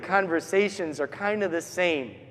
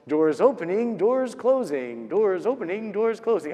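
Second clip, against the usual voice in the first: sing-song recorded door announcement; train announcement. Retold or imitated. Train platform heard from the rectory all night, sing-song announcement. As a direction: chime it out like a repeating platform announcement.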